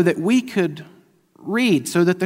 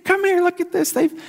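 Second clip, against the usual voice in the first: much higher, loud, hammed-up gushing; giddy excitement; 9 semitones higher, 5 dB louder than usual. Husband calls wife Mandy to the TV pan, hammed-up giddy excitement. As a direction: call her over like a kid with a new toy. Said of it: "I'm like".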